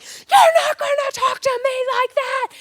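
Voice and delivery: high, strained, slow mock scream; hammed-up screechy indignation; imitated screaming. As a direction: act out an outraged sports argument. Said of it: "they are screaming at each other".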